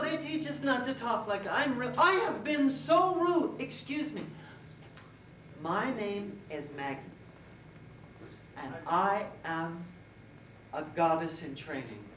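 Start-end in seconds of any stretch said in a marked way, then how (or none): none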